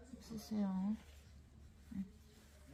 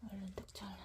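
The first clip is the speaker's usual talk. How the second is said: breathy whispered aside; whispering; 9 dB quieter, much faster than usual. Real, not acted. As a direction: whisper it under your breath.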